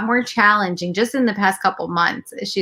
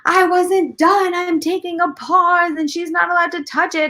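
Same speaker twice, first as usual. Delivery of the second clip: put-on child flipping out; 10 semitones higher than usual. Older daughter protesting from the back seat, high mock meltdown. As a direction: imitate an indignant little kid, pitched way up. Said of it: "starts flipping out, and she's like".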